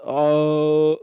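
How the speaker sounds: loud, unimpressed grunt; disinterested staff. Retold or imitated. Staff answering a customer, mock uninterested. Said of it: "that's what they say".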